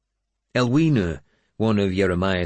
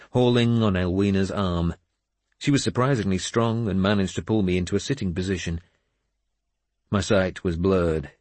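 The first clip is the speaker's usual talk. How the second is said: ordinary delivery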